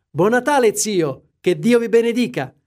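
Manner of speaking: bright, high and loud festive call; cheerful greeting. A narrator's reading. Nephew bursting in to greet his uncle, cheerful and loud. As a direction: burst in full of Christmas cheer. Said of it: "Tutto allegro".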